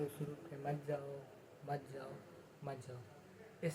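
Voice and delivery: उस रूट पे मत जाओ fading from soft to barely there, very slow; the mind's voice dying away with each मत जाओ; fading inner warning. Retold or imitated. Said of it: "mind कर रहा, कह रहा है". (धीमी होती अंदर की चेतावनी)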